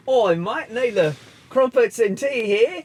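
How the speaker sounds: loud, high British that drifts Australian; mock British accent. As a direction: overdo a bad British accent. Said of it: "your British accent".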